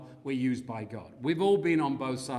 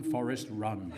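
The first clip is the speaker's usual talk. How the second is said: laughing cheer; 2 semitones higher, 3 dB louder, slower than usual. Retold or imitated. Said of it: "I went".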